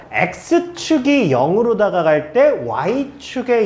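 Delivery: very slow, word by word; slow spelled-out. Shown as a demonstration. Teacher walking students through the graph, very slow. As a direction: spell it out slowly for the class. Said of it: "teacher spells out the reading".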